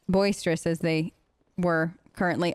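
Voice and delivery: usual speaking voice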